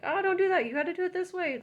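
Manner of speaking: put-on high, loud scolding of the kids; mock scolding. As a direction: snap at them like a stressed mom. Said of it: "I'm like".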